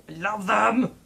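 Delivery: rising, gushing burst of love; too passionate. Real, not acted. Cat owner gushes to viewers about her cats, excitedly. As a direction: gush about them until it spills over. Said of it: "Getting too passionate about cats".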